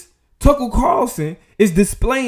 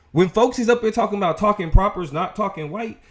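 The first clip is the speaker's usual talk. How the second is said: normal talking voice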